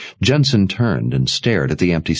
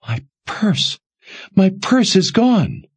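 high, slow panicked cry; discovering her purse is gone; slower than usual. Acted. Jensen discovers stolen purse.

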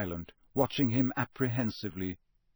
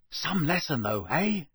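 higher, impressed schoolboy; Ron; 5 semitones higher than usual. A narrator's reading. Boy chats after astonishing lesson, impressed. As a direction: share the thrill with a friend.

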